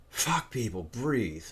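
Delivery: ordinary delivery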